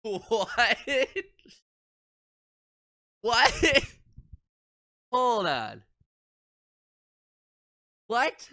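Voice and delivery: high, startled questions; what, hold on, what; shocked disbelief. Real, not acted. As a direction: caught completely off guard. Shocked by poem.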